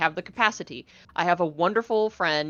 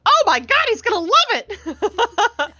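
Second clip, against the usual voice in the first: very high, loud gushing, imitated; excited squeal; 6 dB louder, slower than usual. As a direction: squeal it and stretch out the end. Retells handler's gushing reaction.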